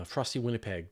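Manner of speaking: usual speaking voice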